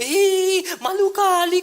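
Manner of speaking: high, loud mocking devil voice; the devil mocking the debt-ridden lecturer, high and loud; devil taunting. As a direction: sneer as the devil would. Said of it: "kata iblis".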